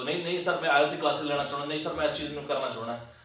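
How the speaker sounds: quick, hurried student pleading with sir; fast-talking student. Shown as a demonstration. Teacher mimicking a student who talks to sir too fast, hurried. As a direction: rush through it like an over-eager student. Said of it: "ਉਹ ਇਸ ਤਰੀਕੇ ਨਾਲ ਬੋਲਦਾ".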